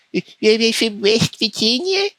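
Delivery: high, grating goofy impression; mocking character voice. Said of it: "And this is his voice".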